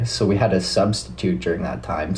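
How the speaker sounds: normal talking voice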